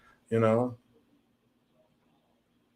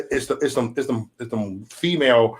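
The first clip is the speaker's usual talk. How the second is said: ordinary talk, nothing marked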